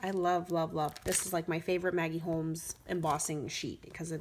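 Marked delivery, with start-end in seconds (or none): none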